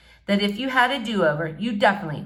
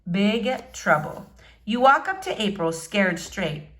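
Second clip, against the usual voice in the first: usual speaking voice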